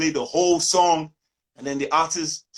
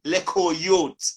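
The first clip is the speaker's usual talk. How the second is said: louder Jamaican patois, mocking his ragamuffin; 4 semitones higher, 6 dB louder than usual. Jamaican friends mock his ragamuffin in Jamaican patois. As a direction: scoff at him in a louder Jamaican accent.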